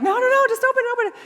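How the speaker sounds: high impatient pleading; impatient excitement. Retold or imitated. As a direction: beg him to open it right now. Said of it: "I'm the most impatient person".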